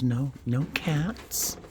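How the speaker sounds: ordinary talk, nothing marked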